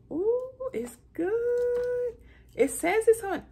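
high, delighted ooh; excited. Real, not acted. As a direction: rave about it. Raving about the show.

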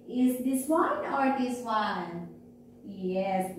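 ordinary delivery

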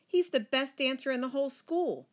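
high, gushing schoolgirl delight; excited squeal. Acted. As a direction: gush about your dance partner. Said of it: "I squealed".

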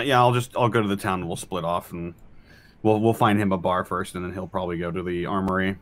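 ordinary delivery

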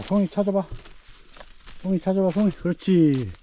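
high, sing-song dog-training voice; talking to a dog. Real, not acted. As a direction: coax the dog to sniff out mushrooms. Training dog to find mushrooms.

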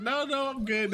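high-pitched insistence; retold brushing-off. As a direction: act out waving off concern in a high voice. Retold reply to bystanders.

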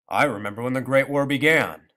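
put-on loud, proud old grandfather. A narrator's reading. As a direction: declare it loudly with pride. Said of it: "said grandfather proudly".

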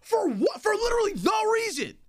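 furious shouting rant; berserk rage. Real, not acted. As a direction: yell at them, outraged. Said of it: "literally screaming at the top of my lungs".

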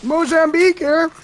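Mozambique callout voice. Acted. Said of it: "All I'm doing is my normal voices".

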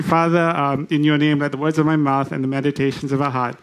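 low, subdued prayer voice. Real, not acted. Preacher praying to God before opening the scripture, hushed prayer. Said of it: "let me just pray very quickly".

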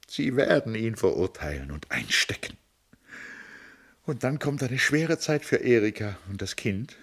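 lightly voiced sobbing sister to Tom; sobbing lament. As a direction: pour out her despair through sobs. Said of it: "sagte sie schluchzend".

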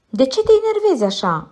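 rattled off fast and high; quick high question. Acted.

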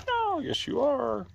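sing-song puppy talk; pet voice. Real, not acted. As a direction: coo it up high to the dog. Cooing to her pets.

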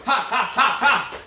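short high laugh between calls; breathless laugh. Real, not acted. Coach laughing at his class during the burnout, high and breathless. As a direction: laugh it out while pushing through.

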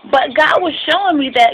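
ordinary talk

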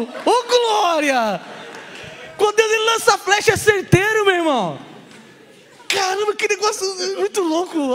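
high, loud, slow exclamations of joy; loud joyous hallelujahs; ecstatic praise. Pretend. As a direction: rejoice out loud, stretching each cry.

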